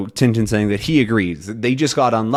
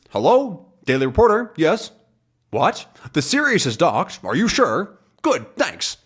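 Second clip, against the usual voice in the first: higher, brisk phone answer; a hint of a busy newsman; reporter voice; 6 semitones higher than usual. Reporter taking a tip by phone, brisk and higher. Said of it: "now he's a reporter".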